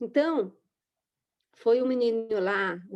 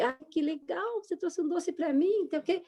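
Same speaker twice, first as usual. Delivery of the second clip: softer and higher, sweetly pleased; delighted thanks; 5 semitones higher, 5 dB quieter than usual. Retold or imitated. Teacher thanking a small boy for his cookie, soft and higher. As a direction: coo your thanks to a child.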